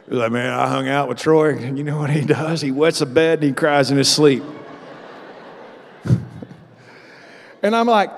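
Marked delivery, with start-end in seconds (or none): 0.3-4.4 lightly voiced gossiping buddy; a hint of a buddy passing on a juicy tale; tattling roommate. Roommate tells others about his friend, lightly mocking gossip. Imagines the roommate telling on him.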